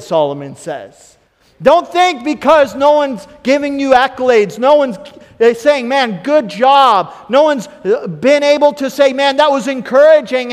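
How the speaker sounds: loud, high preaching; rousing preacher's encouragement. Acted. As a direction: preach it out to the church with force. Preaching at full force.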